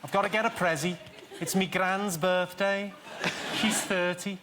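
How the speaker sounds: Liverpool accent in measured phrases; demonstrative, sing-out Scouse. Shown as a demonstration. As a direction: say it in broad Scouse, pausing between sentences. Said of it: "authentically Scouse".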